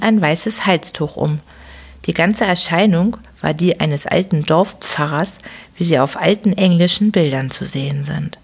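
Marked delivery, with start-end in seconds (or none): none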